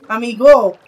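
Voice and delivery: loud call across the water. Real, not acted. Calling out to his drowning friend in the game, loud call. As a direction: shout the name to reach him.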